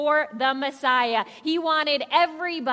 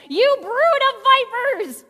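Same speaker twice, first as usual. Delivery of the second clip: loud, higher accusing yell; yelled insult; 8 semitones higher, 7 dB louder than usual. Retold or imitated. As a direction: yell it at the hypocrites. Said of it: "John the Baptist said".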